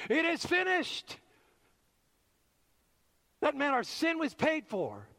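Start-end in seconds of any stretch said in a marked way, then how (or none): 0.1-1.2 a touch of a triumphant cry, raised high; victorious cry. Retold or imitated. Preacher voices Jesus on the cross, lightly triumphant. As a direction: call it out in mild triumph. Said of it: "he cried out in a loud, victorious voice".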